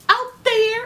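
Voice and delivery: put-on high little-kid answer; toddler voice. Imitating Gabe telling his foster mom where mommy is, high toddler voice. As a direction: say it like a small boy. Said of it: "Gabe answers where mommy is".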